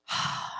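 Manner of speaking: demonstrated exasperated exhale; frustrated sigh. Shown as a demonstration. Parent showing kids how to handle frustration, sighing. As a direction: let out a heavy sigh before speaking.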